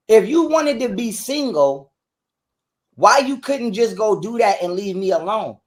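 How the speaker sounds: ordinary talk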